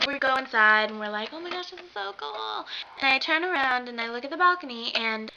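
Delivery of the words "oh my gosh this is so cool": high, soft mock squeal; retold starstruck squeak; hushed excitement. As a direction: gush in a high, small voice. Retold excitement on going inside.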